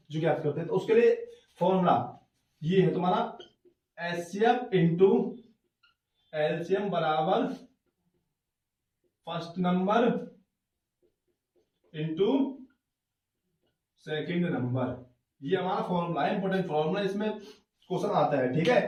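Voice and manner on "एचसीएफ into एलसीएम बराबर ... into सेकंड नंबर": slow, with long pauses between terms; slow dictation. Real, not acted. Teacher writing out the formula for students, slow dictation. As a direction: dictate it piece by piece for note-taking.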